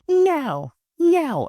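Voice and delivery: high, put-on snotty little girl; bitchy refusal. Woman refuses the man, high imitated bitchy voice. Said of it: "the little bitchiest".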